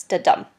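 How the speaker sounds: "da-dum" with a light "da" and a stressed "dum"; "da-dum" as one unstressed-stressed beat; iambic stress. Shown as a demonstration. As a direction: lean on DUM in "da-dum". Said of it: "The first is unstressed and the second is stressed".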